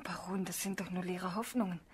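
soft unvoiced whisper. Marie whispers her doubt to the baron. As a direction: breathe it doubtfully.

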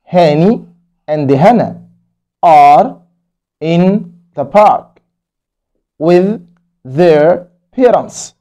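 in the park read very slowly; slow story reading. Acted. Teacher reading the story aloud to kids. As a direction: read it slowly for young learners.

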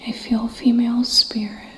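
whispered, on edge, listening hard; tense whisper. Real, not acted. Ghost hunter hearing footsteps, whispering to viewers. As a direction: whisper as if someone is right behind you.

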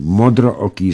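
ordinary delivery, nothing marked

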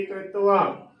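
ਕ੍ਰਿਤਵਾ said kre-tu-va; "kretuva" for ਕ੍ਰਿਤਵਾ; demonstrated pronunciation. Shown as a demonstration. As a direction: add a short u between t and v in ਕ੍ਰਿਤਵਾ. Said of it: "ਰਾਰੇ ਨੂੰ ਸਿਹਾਰੀ ਲੱਗੇਗੀ".

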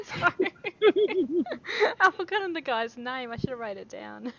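high, loud, helpless laughter through the words; embarrassed, breaking into laughter; real laughing apology. Real, not acted. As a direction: confess it while cracking up. Player teased, laughing at forgetting.

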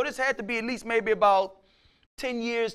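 ordinary talk, nothing marked

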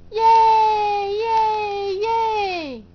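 high, loud cheer for the kittens; yay them on like a happy crowd. Pretend. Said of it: "Can you cheer for them?".

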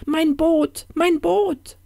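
high, loud woman's wail; wailing lament. Acted. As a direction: lament a loss in dismay. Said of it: "jammerte sie".

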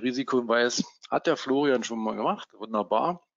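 ordinary delivery

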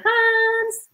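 high, long-held name; delighted call. Real, not acted. Host calling her best friend Hans, delighted high call. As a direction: sing out the name high and drawn-out.

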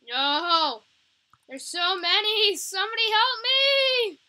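loud, high frantic yell for help; desperate cry for help, rising in pitch; panicked scream. Real, not acted. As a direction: scream as the horde swarms you. Overrun by a vampire horde.

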